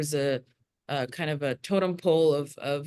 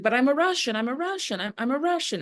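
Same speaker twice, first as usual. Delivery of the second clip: pleading protest; 7 semitones higher than usual. Retold or imitated. Brother protesting in retold story.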